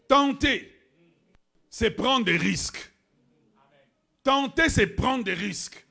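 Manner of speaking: loud, high preacher's cry; full force preaching. Acted. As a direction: hammer it at the congregation. Prêche à pleine voix. (prédication à pleine voix)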